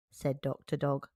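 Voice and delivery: usual speaking voice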